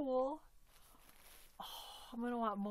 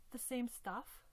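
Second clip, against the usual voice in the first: ordinary talk, nothing marked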